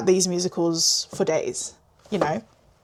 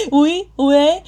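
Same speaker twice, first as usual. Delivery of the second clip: mock French; 6 semitones higher, 6 dB louder than usual. Laughs and plays up French.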